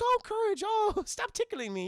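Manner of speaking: high, fluttery cartoon granny; put-on high voice, playfully protesting; tickled old lady. Muriel giggly at being tickled.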